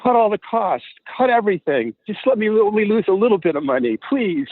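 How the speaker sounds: high-pitched acted-out plea; pleading. Acting out a desperate money-losing plea.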